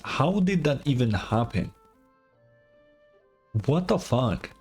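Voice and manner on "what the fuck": ordinary talk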